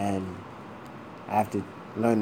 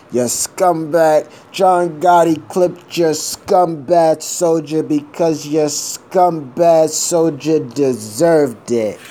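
slow, loud, drawn-out mob boss delivery, pitched up; movie mobster line; 7 semitones higher, 8 dB louder, much slower than usual. Retold or imitated. Recalling a Gotti movie scene, slow loud mobster voice. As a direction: spit it out like a mafia don quoting a scene. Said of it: "it reminds me of the John Gotti movie".

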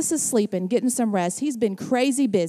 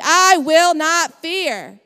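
slow, louder, every word weighted; slow call and response; 5 semitones higher, 6 dB louder, much slower than usual. Shown as a demonstration. Pastor leading the congregation in repeating, slow and loud. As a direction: lead the crowd in it slowly. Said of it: "Say that with me".

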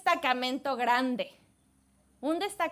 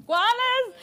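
incredulous servant; 9 semitones higher, 6 dB louder than usual. Retold or imitated. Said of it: "Me imagino a Jesse así como".

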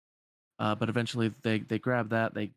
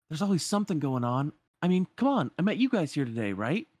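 higher, insistent hero voice; upbeat Aladdin; 6 semitones higher than usual. Acted. Aladdin talking up the city to Sora, lightly insistent. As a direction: make the case brightly as the young hero.

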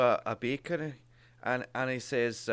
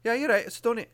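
put-on the baker's higher reply in a light Glaswegian accent; Glasgow accent; 8 semitones higher, much faster than usual. Baker answering the customer, light Glaswegian accent. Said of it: "I'll do it again".

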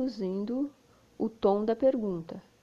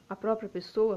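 ordinary talk

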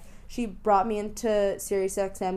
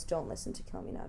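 whispered aside; 11 semitones lower, 11 dB quieter than usual. Acted. Joking aside to the audience.

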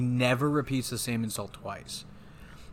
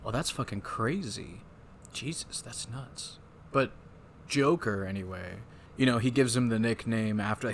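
ordinary talk, nothing marked

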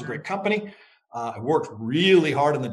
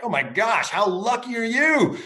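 higher, excited exclamation of luck; amazed neighbor; 8 semitones higher than usual. Retold or imitated. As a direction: gasp at his good fortune, voice rising high. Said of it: "the neighbor's like".

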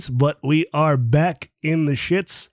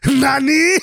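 anime shock; 9 semitones higher, 5 dB louder than usual. Retold or imitated. Echoing friend's anime joke.

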